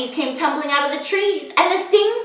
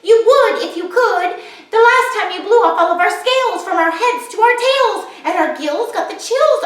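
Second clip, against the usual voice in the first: fish voice; 7 semitones higher than usual. Acted. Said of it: "said the fish".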